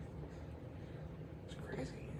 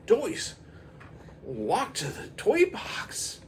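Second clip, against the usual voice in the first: high, slow questioning command; a confused boy ordering toys; puzzled kid; 13 semitones higher, slower than usual. Acted.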